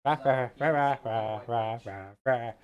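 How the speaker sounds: loud mock roars, fading out; playful roaring chant. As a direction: chant rah loudly, then let it die down.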